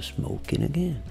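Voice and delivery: soft practice phrase; intimate voice. Shown as a demonstration. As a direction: say it quietly to yourself. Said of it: "Voz íntima é uma". (voz íntima, baixinho)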